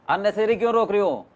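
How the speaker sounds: usual speaking voice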